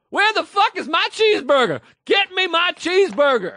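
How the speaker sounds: very high, loud shrieking demand for a burger. Retold or imitated. Angry customer yelling around the corner for his cheeseburger, shrill high shriek. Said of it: "that accent sounded off".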